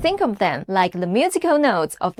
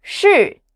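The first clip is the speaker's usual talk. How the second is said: "Shi." from high straight down; fourth tone; 5 semitones higher than usual. Shown as a demonstration. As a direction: drop "Shi." short and sharp. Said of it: "The fourth tone drops fast".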